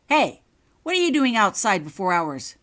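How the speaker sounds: sharp, loud call across the hall; loud challenge. Acted. Prefect Richard calling out to a student sneaking about, loud and sharp. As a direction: catch her out with a loud shout.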